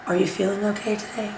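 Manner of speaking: hushed, gentle check-in; very soft whisper. Acted. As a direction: lean in and ask very softly. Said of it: "whisper very softly and be like".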